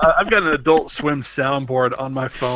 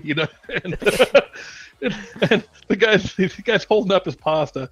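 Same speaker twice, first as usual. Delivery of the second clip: ordinary delivery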